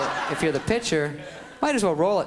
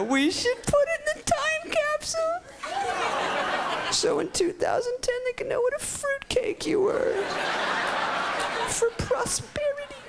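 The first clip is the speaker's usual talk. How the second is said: very high, drawn-out squeaky jeer; mock him in a slow squeal; taunting schoolkids. Schoolkids proposing a time capsule, high squeaky taunt.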